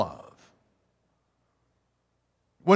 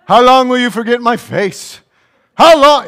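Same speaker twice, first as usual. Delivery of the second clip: higher and louder, hammed-up pleading whine; mock desperate whine; 9 semitones higher, 12 dB louder than usual. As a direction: wail it like a clingy lover who can't cope. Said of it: "with this kind of an attitude".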